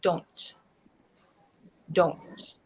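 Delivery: "Don't." with the D kept soft and not pushed; a gentle "Don't." with a barely tapped D; neutral light D. Shown as a demonstration. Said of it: "The way I say it is".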